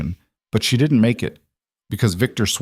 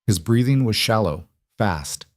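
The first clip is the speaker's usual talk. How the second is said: plain reading voice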